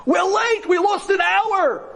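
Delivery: very high, impatient yell; friend calling out. Retold or imitated. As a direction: holler like a friend desperate to leave. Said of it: "And I hear my friend".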